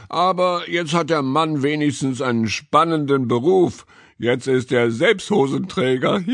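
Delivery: high, animated cat voice; amused cat character. A narrator's reading. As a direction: joke gleefully as the cat. Cat character joking about man.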